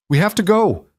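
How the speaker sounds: high urgent shout. A narrator's reading. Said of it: "she shouted".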